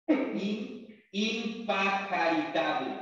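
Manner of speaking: "impajaritable" spelled out slowly, piece by piece; slow word presentation. Shown as a demonstration. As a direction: break off after "In", then stretch out "impajaritable". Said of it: "Pon mucha atención a la siguiente palabra".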